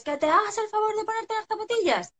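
high-pitched mock scolding yell; yelling at a child. Parent ordering child into slippers, exasperated high yell. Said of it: "le pegas la voz al niño".

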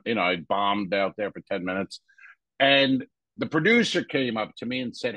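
ordinary delivery

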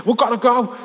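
put-on high, hurried-sounding Scouse accent; urgent Scouse. Lad pulling his mate away, high urgent Scouse. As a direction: urge him away in Scouse. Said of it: "give you a glimpse of the scouse".

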